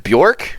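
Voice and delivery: "Bjork" said as "BYORK", one syllable; correct Bjork. Shown as a demonstration. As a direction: say "Bjork" with a y glide after B.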